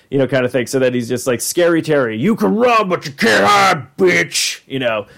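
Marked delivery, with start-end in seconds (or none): 1.4-4.6 high, shouted cartoon bogeyman taunt; Scary Terry. Dream monster threatening his prey, shouted high cartoon voice. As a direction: stretch it out as a hammed-up monster. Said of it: "trying to remember how Scary Terry sounded".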